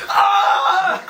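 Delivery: loud jolt-awake scream; startled scream. Retold or imitated. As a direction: scream as if woken by fright. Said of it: "he's just like".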